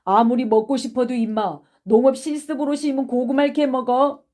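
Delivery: indignant schoolboy accusation; accusing. Acted. Boy accuses classmate of theft.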